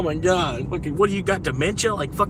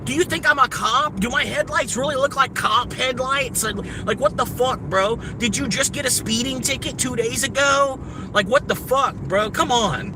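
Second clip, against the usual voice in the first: hound the car ahead to speed up; angry driver rant; 6 semitones higher than usual. Real, not acted. Ranting at a slow driver.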